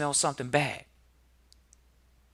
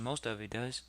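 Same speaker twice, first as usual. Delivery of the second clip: ordinary talk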